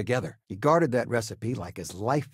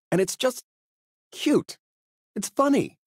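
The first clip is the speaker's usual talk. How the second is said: gushing delight; 12 semitones higher than usual. Acted. Gushing about little Ashta.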